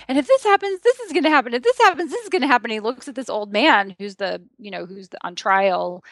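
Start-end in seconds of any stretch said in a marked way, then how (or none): 0.1-2.7 high, animated lawyer laying out consequences; lightly acted defense attorney; lawyer quote. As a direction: rattle off the what-ifs like a worried attorney. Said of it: "And he's like".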